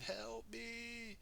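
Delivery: high, soft, drawn-out cry for help; mock scream. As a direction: imitate a distant scream, kept quiet. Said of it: "You see her screaming".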